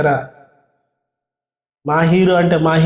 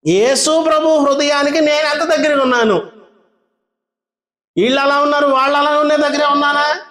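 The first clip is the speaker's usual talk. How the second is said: preaching at full force; 9 semitones higher than usual. Real, not acted. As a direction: drive the point home to the church.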